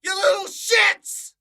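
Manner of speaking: high, loud mock abuse; hammed-up furious yell; angry rant. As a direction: scream it at the whole room. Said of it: "this is what you were like in network meetings".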